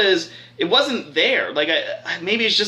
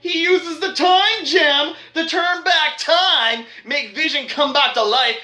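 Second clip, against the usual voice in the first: hyped plot reveal; 6 semitones higher, slower than usual. Real, not acted. Reviewer revealing that Thanos turns back time, hyped and high. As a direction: burst in with the shocking twist.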